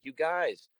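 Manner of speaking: high, drawn-out gushing news anchor; slow, high mock good-news delivery; happy news story. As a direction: announce it like heartwarming local news. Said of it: "local news wants to do some fucking happy story".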